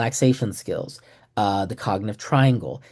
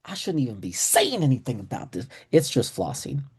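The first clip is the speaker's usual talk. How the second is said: higher, casual bro voice; chill dentist bro; 4 semitones higher than usual. Retold or imitated. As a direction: talk like a laid-back buddy letting you in on a secret. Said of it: "He's like".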